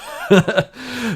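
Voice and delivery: high giggling laugh; amused laugh. Real, not acted. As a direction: laugh it off after the punchline. Amused at the episode's ending.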